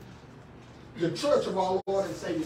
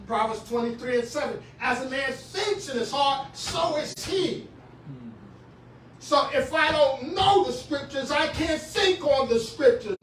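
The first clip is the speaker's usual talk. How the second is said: higher and louder, ringing sermon cadence; preaching at full force; 6 semitones higher, 6 dB louder than usual. Acted. Pastor warning congregation of the devil, full-force preaching. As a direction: whoop it up for the pews.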